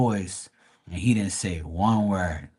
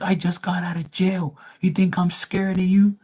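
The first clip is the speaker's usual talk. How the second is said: higher put-on voice of the tough guy; tough-guy boast; 8 semitones higher than usual. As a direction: brag about jail to scare him. Customer boasts jail time.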